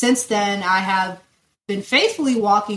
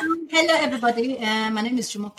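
put-on strong Nigerian accent on her name; 3 semitones higher, faster than usual. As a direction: introduce yourself in a Nigerian accent. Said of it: "In the most Nigerian accent".

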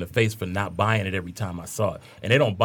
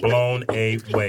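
slow emphatic echo; much slower than usual. Pretend.

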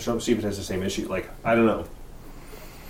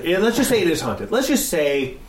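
high and loud, worked up; eager, rising excitement; excited; 9 semitones higher, 4 dB louder than usual. Real, not acted. Excited hypothetical about haunting.

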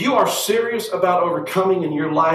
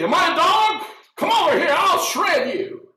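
Goliath roaring. Retold or imitated. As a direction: roar it like the giant. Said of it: "Goliath to roar and say".

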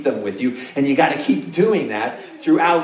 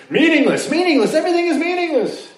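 exaggerated proclamation; 14 semitones higher than usual. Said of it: "he says".

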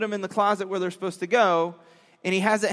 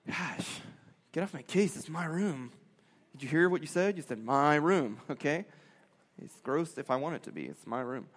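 soft, lower mumbling grumble; sulky kid; 5 semitones lower, 9 dB quieter than usual. Retold or imitated. Kid to parent about room, soft grumble. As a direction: mutter it like a sulky teen.

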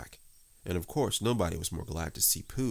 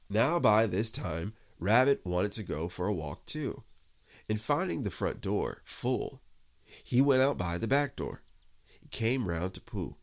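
plain reading voice

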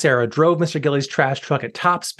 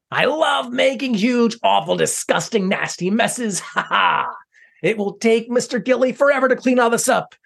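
higher, hammy cartoon villain, bursting into a shout at first; gloating villain voice; 4 semitones higher than usual. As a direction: revel in the mess like a silly bad guy.

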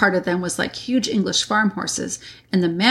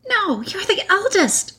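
very high, slow, voiced stage whisper; whispering girl; 12 semitones higher, slower than usual. A narrator's reading. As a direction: protest as a girl in a mock whisper. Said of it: "whispered Susan".

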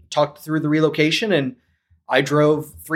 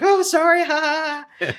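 high, giggly sorry with mock laugh; mock giggle, much higher; imitated laughing apology; 14 semitones higher than usual. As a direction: play her giggling through the apology. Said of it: "starts laughing".

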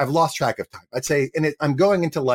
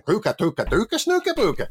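Swedish-sounding gibberish; 9 semitones higher than usual. Retold or imitated. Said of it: "more, like, Swedish sounding".

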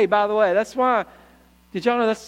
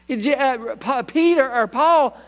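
ordinary talk

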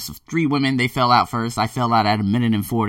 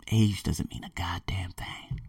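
slow, unvoiced whisper; 3 semitones lower, 9 dB quieter, slower than usual. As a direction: whisper it slowly like a secret. Quiet words to woman at gym.

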